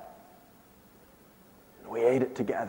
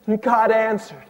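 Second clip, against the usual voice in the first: ordinary talk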